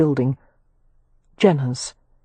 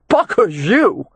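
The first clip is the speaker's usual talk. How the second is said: put-on slow, raised drunken insult; higher, slurring brawler's outburst; angry curse; 4 semitones higher, 3 dB louder, slower than usual. A narrator's reading. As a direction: spit out a drawn-out curse. Brawler curses before charging.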